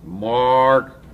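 loud, high, slow imitation of a huge rough guy; Bobby W. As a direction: draw it out and holler it, mimicking his slow talk. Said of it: "Talks real slow and talks like this".

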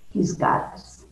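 whispered reassurance. Real, not acted. Closing reassurance after sermon.

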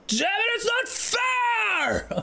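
high, drawn-out anguished cry; frustrated outburst. Acted. Actor voicing Apollo's outburst at unfairness, frustrated high yell. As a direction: yell it high, stretching the last word.

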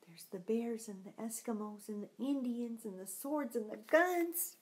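whispered pointing out; hushed aside over the pictures. Real, not acted. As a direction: breathe it like a secret.

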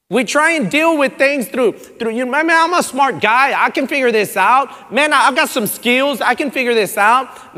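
mock cocky inner voice, loud; a hint of a proud man bragging to himself; self-reliant boasting. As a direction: play the smug guy who can handle it.

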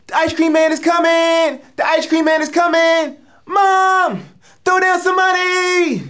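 put-on very high, loud, drawn-out kid yell; excited kid. Comic as a kid yelling to mom, excited. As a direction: holler it slowly like a child calling up to mom. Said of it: "You'd be like".

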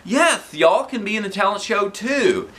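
high, bright-pitched principal. A narrator's reading. Principal telling teachers they can join, high cheery. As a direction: welcome them all cheerily.

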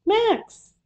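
high-pitched calling out; short high shouts; shouting a name. A narrator's reading. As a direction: call for a friend at the door. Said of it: "he shouted".

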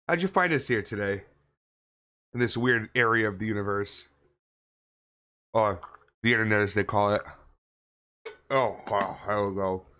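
talking around a mouthful of ice; mouth full of ice. Real, not acted. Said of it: "I'm sorry I'm talking with my mouth full of ice".

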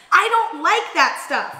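loud, high, slow refusal; emphatic dismissal. Real, not acted. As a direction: wave it off firmly. Dismissing cooking.

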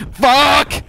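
loud high shocked swear; startled yell. Real, not acted. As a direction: yelp it out in fright. Caught by the monster.

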